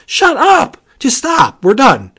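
high-pitched, disbelieving "shut up" outburst; mock-outraged amazement. Real, not acted. Comic fan declaring all art finished, overwhelmed amazement. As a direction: start very high, then come down on the last words.

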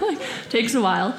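ordinary talk, nothing marked

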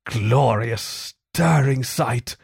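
slow, drawn-out wonder; lightly voiced dreamy Toad, lingering on words; rapt murmur. A narrator's reading. Toad gazing after the motor car, rapt murmur. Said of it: "murmured Toad".

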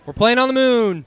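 high, loud hype shout; loud cocky finance bro. Pretend. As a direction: yell it like a pumped crypto bro.

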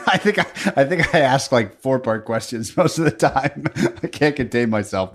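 self-mocking admission broken up by laughter; laughing. Real, not acted. Host admitting to the guest he rambles, laughing. Laughs at own long questions.

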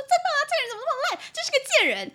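high, loud, fast exaggerated gushing; mock gossip agreeing. Mocking a friend who joins the insults, high and loud. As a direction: ham up a gossipy friend piling on. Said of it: "我不会说".

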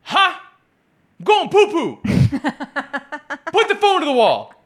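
put-on very high strained old man voice; high silly old man voice; old husband on the toilet. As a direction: play a flustered old man mid-toilet. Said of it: "The patient goes".

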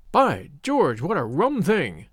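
plain reading voice

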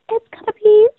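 imitating Rachel's calm voice. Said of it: "I can't do her voice".

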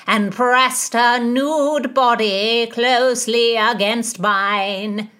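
loud, slow sing-song recitation; declaiming bawdy lord. A narrator's reading. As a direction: intone the verse in long drawn-out vowels. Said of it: "Sir Francis went on".

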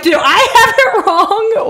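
high, drawn-out worried question; retold panic. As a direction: replay your panicked self-doubt, hammed up. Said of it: "Meanwhile, I'm panicking".

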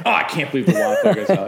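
high, loud, laughing mock whine over the Wi-Fi; mocking a passenger upset that the Wi-Fi died, loud and high; mock outrage. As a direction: ham up a spoiled traveller's outrage. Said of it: "people are like".

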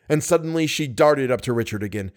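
ordinary delivery

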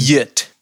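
"Yit" opening on a y sound instead of j; "Yit" as one syllable, rhyming with "sit"; soft J pronunciation. Shown as a demonstration. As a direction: say "Yit" with the J softened to a y. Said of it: "with a soft J".

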